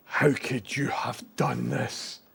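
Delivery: slow, hushed mock heartbreak; dramatic whisper. Mourning the dog-chewed paper, mock-dramatic whisper. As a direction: whisper it like a betrayed lover.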